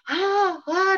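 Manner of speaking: high, loud "ah" yells; acted frustrated scream. Preacher acting out David's outburst in his tent, screaming. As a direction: mimic a man screaming out his rage. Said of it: "dia teriak-teriak".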